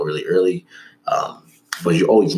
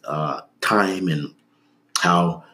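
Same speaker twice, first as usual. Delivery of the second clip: usual speaking voice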